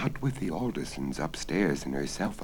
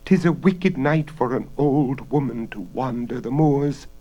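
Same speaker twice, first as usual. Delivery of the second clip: put-on feeble old woman; 8 semitones higher, 5 dB louder than usual. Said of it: "the feeble voice implored".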